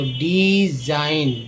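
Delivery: डिजाइन teacher-slow; slow clear word. Shown as a demonstration. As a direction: give डिजाइन slowly, stressing the end. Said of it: "डी को डी बोलते हैं".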